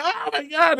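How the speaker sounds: high hammed-up gasp of shock; mock shock. Cubans hearing the messenger, high mock shock.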